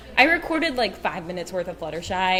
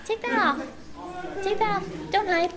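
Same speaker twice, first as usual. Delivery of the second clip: playful coaxing; 11 semitones higher, 3 dB louder than usual. Real, not acted. Coaxing co-panelist out.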